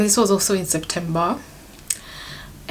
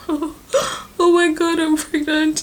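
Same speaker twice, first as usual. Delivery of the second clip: high, slow, breathless "oh my God" in disbelief; shocked excitement; 8 semitones higher, much slower than usual. Retold or imitated. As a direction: gasp it out, stunned and overjoyed. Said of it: "It was like that".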